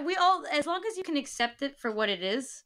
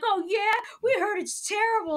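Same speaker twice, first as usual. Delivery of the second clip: higher-pitched mocking imitation of naive people; mock clueless countrymen; 8 semitones higher than usual. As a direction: parrot them in a raised, mock-knowing voice. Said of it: "the idiots in my country".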